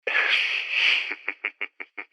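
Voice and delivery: low breathy villain laugh; sinister laugh. Acted. Villain alone with his captive.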